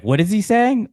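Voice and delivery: high, loud puzzled question; confused reaction. Retold or imitated. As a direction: act baffled. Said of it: "and you're like".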